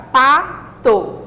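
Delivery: PATO split as pa, to with a clear gap; syllable by syllable. Shown as a demonstration. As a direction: break PATO into two slow beats, pa and to. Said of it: "palavras com duas sílabas".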